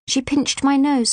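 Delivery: plain reading voice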